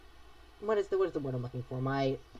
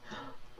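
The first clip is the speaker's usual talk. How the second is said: soft gasp; 9 semitones higher, 13 dB quieter than usual. Real, not acted. Delighted by gift art.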